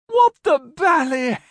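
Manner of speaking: high, drawn-out outraged shriek; obnoxious shriek. A narrator's reading. Young lieutenant to the man on the ferry, obnoxious shriek. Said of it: "Palmer shrieked obnoxiously at".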